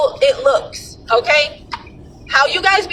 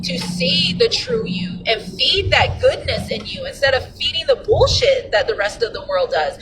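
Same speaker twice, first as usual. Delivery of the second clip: usual speaking voice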